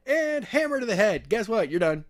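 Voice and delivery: gloating villain. Retold or imitated. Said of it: "and then was just like".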